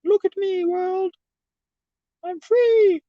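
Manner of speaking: very high falsetto mocking voice; mock carefree Leno. Mocking Leno to chat in a high falsetto. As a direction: squeal it out like a carefree fool. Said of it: "just being like".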